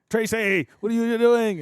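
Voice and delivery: high, loud play-fight yell at his partner; hammed-up shout, as if fighting; mock angry yell. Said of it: "And be like".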